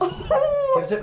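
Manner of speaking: squealing give us it; excited high yelp. Real, not acted. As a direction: shriek it with glee. Excited, grabbing for the camera.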